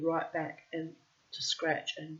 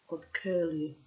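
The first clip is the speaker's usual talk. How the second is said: usual speaking voice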